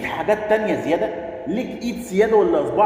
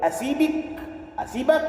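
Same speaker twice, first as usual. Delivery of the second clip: higher, whiny rising mimicry; mock lover's threat; 9 semitones higher, 4 dB quieter, slower than usual. Mocking the partner who threatens to leave, higher whiny mimicry. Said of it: "لكن لما تيجي تقول لي".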